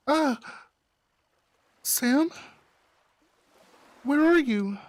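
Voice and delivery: high, loud panicked call into the dark; frightened call. Acted. Alone in the dark, calling out.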